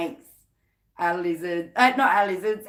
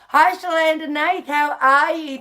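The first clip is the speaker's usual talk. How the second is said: higher, louder, delighted hello; excited greeting; 6 semitones higher, 6 dB louder than usual. Real, not acted. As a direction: call out a big hello to a newcomer. Greets a viewer joining live.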